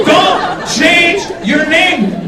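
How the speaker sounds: rally the crowd; mock rally shout.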